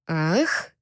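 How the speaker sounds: surprised, teasing repeat; mocking echo. A narrator's reading. Captor echoes her moan, puzzled and teasing. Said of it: "Альфа поднял брови от удивления".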